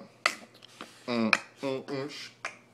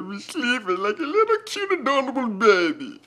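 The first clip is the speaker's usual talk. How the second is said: put-on funny baby voice; 6 semitones higher than usual. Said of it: "That's a funny ass voice".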